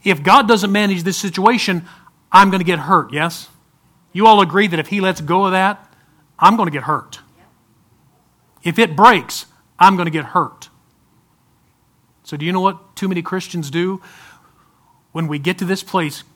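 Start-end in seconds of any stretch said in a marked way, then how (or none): 0.0-10.7 loud emphatic preaching voice. Acted. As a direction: drive the warning home to the congregation. Preacher dramatizing with rope.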